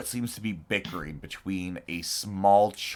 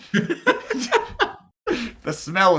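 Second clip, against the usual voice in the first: laughing; 12 semitones higher, 4 dB louder than usual. Real, not acted. Laughing narration.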